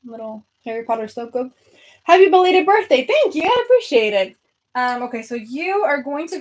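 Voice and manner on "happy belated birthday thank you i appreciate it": excited thanks. Real, not acted. Reading chat greeting excitedly.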